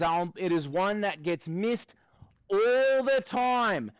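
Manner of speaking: put-on Australian accent; Aussie accent. Maths teacher asking students for help, put-on Aussie accent. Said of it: "we'll do this in an Aussie accent".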